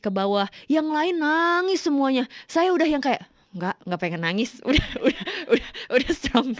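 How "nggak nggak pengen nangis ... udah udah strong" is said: talking through giggles; mock-brave words broken by laughter; laughing self-quote. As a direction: laugh while insisting you are fine. Laughs at her own resolve.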